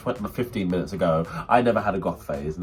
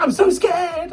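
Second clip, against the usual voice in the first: high, loud imitation of a panicking friend; hammed-up frightened squeal; mock scared screaming; 5 dB louder, much slower than usual. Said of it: "you were screaming and running around in circles".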